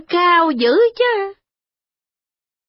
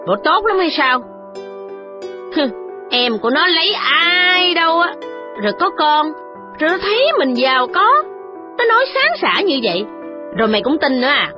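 put-on much higher, scornful old lady snapping; snapping scold; 8 semitones higher than usual. A narrator's reading. The matron scolding her gullible daughter, scornful snapping. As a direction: scoff and scold her, sharp and high. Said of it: "Bà cay nạt rằng".

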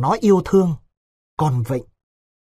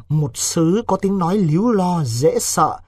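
ordinary talk

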